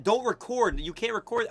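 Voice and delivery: high-pitched imitation of a man forbidding; retold high scolding; imitated scolding. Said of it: "he's like".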